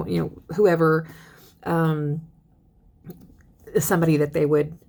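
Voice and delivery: ordinary talk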